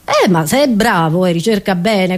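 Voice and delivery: ordinary talk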